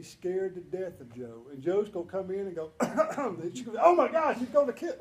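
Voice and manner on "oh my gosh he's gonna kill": higher, much louder mock alarm; mock panic. Mocking a new teacher scared of Joe, loud panicky. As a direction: squeal it like a terrified new teacher.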